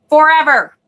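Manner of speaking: exasperated outburst. Real, not acted. Fed up with a deck that never came, loud exasperated cry. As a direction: throw out Forever in frustration.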